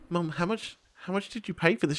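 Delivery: quiet, careful question to mum; hushed, wary asking; restrained disbelief. Retold or imitated. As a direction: ask it softly, holding back the disbelief. First, restrained ask to mum.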